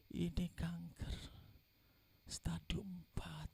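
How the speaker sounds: slow, soft, half-whispered retelling; hushed bad news. Doctor telling the wife about stage four cancer, hushed. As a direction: break it gently in a slow hush.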